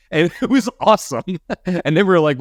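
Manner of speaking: high-pitched, amused retelling through laughter; tickled by the memory, voice lifted; laughing. Real, not acted. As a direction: laugh your way through the words. Laughing while recalling the impressions.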